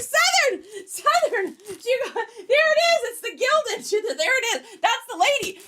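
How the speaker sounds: high, urgent retold shouting; excited calling out. Calling Southern over to see the Gildan box, excited high shouts. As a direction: call out excitedly, half laughing.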